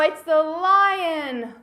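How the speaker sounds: loud, slow, dramatic announcement; hammed-up amazement for children; excited reveal. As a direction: stretch out the big reveal. Revealing the animal at the door.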